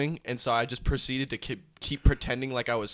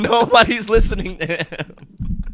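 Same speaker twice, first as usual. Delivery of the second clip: slow, loud, high words through laughter; laughing hard; 10 semitones higher, 13 dB louder, slower than usual. Real, not acted. Friend mocking the coworker's excuse, laughing loudly. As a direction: repeat it loudly while cracking up. Cracking up while repeating the line.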